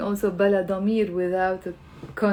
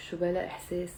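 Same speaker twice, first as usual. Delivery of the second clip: شو بلا إحساس said much softer, trailing; softer repeat; 4 semitones lower, 10 dB quieter, much faster than usual. Shown as a demonstration. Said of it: "You say".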